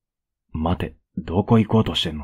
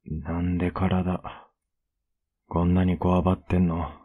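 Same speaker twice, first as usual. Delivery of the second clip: soothing. Acted. Soothing a panicking partner. (優しくなだめるように)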